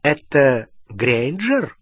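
usual speaking voice

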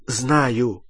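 plain reading voice

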